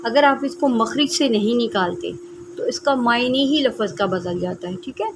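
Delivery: ordinary talk, nothing marked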